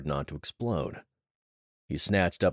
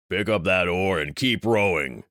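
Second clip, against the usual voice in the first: stern commander; 5 dB louder than usual. Acted. Commander giving orders on boat.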